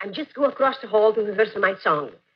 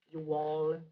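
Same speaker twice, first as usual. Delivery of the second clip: southern drawl joke; 9 semitones lower, 14 dB quieter than usual. Shown as a demonstration. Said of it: "Spanish with a southern accent".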